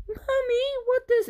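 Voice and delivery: put-on a child asking mummy, first word squeaked high; little kid voice. Child asking mum about a bad word, squeaky innocent kid voice. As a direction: play an innocent five-year-old asking. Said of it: "five-year-old might go and see this and be like".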